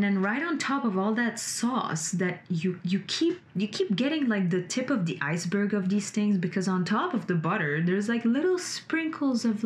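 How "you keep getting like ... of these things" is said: ordinary talk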